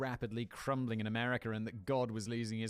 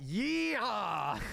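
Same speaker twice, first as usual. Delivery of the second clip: high, loud yeehaw whoop; hammed-up cowboy yell; mock cowboy Tucker; 8 semitones higher, 3 dB louder than usual. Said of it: "But I imagine he's like".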